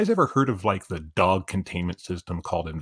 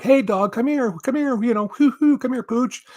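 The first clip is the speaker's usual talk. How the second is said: high-pitched calling-out, louder than usual; yelling to a dog; 5 dB louder than usual. Retold or imitated. Man yelling to a wandering dog, high calling voice. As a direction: call the dog over in a raised, high voice. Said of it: "I'm all yelling to the dog".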